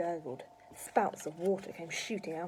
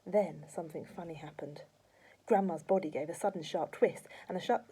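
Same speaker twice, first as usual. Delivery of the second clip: usual speaking voice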